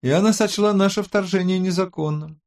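high-pitched woman's character voice; Роза. A narrator's reading. Tech expert tells the team they're blocked, high voice. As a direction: pitch it high to deliver the bad news. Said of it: "объявила Роза".

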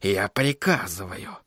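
low, slow, soft command; whispering. A narrator's reading. Sorcerer giving his crystal an order, whispering. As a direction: give the order in a hushed voice. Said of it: "прошептал он".

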